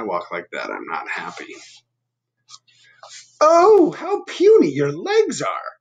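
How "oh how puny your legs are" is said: high, loud, slow jeering cry; the birds call out their taunt; a high mock cry of scorn; crying out mockingly.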